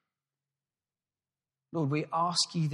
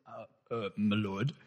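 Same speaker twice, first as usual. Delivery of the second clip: lightly voiced timid butler; a hint of a hesitant servant; 6 semitones lower than usual. Butler Carson timidly addressing the Earl, lightly voiced. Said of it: "that's my butler voice".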